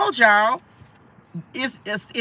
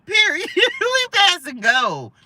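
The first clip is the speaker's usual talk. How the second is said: laughing; 10 semitones higher, slower than usual. Real, not acted. Excited card reading, laughing.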